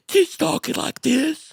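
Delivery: high, slow put-on voice; Sue Dillon impression. Teasing cohosts' nonstop Sue Dillon talk, high slow voice. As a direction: mimic the character, high and drawn out. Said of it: "we've been talking like Sue Dillon".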